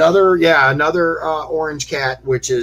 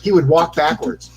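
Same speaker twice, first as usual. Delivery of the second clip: ordinary delivery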